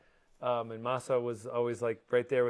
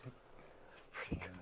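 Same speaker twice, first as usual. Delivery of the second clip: whispering; 7 semitones lower, 19 dB quieter, slower than usual. Real, not acted. Whispered quiet remark.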